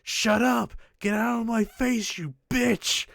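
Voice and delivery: shouted high and loud, furious; angry shout. Retold or imitated. Retelling the prison scene, yelling high and loud. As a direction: bark it out like a furious delinquent. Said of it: "you see him say".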